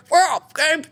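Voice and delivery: high, stumbling, laughing put-on voice; flustered mock comeback. Mocking the plum's weak reply, high and flustered. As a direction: fumble the comeback in a squeaky voice. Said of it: "He go".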